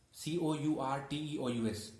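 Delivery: normal talking voice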